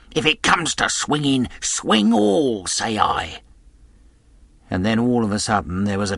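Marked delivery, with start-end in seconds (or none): 0.1-3.4 high-pitched heated outburst; angry cry. A narrator's reading. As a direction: cry it out, peaking high then dropping. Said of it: "And again".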